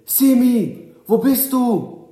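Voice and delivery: loud, high-pitched yell with drawn-out words; calling out. A narrator's reading. Boys calling into the bushes for their missing friend, loud high shout. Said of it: "Sie riefen noch bevor sie reinkletterten seinen Namen".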